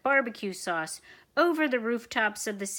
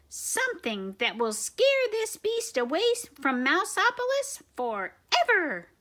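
lightly voiced professor; 6 semitones higher than usual. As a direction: explain like a calm scientist. Professor explains.

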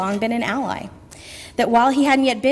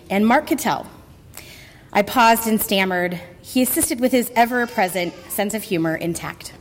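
normal talking voice

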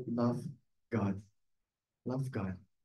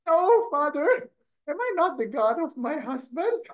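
mock pleading wife; 12 semitones higher, 3 dB louder than usual. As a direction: play the wife begging the priest, much higher. Parishioner voiced pleading to priest.

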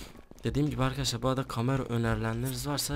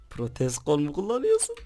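mocking taunt; 4 semitones higher, 5 dB louder than usual. Real, not acted. Mocking the enemy's aim.

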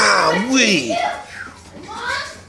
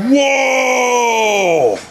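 ordinary delivery

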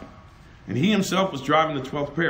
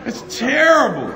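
loud, high amused outcry; laughing exclamation; 9 semitones higher, 4 dB louder than usual. Real, not acted. Preacher reacting to the congregation about the story, loud laughing cry. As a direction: burst out it's terrible through laughter.

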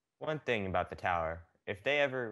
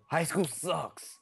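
slow high whine; drawn-out whine; 7 semitones higher, much slower than usual. Acted. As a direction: whine it out slowly. Mock complaint to viewers.

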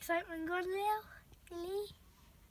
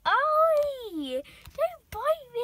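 high, loud yelp then slow scold; yelp, then drag out the scolding; ouch oi; 7 semitones higher, 9 dB louder, much slower than usual. Real, not acted. Cat bites owner, high loud yelp and scolding.

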